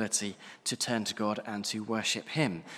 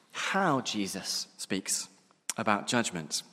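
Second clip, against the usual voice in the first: slowed way down, each word laid out; drawing the congregation's attention slowly; slow, weighted phrase; slower than usual. Shown as a demonstration. Preacher announcing his point, slow and deliberate. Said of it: "is the first thing for us to see".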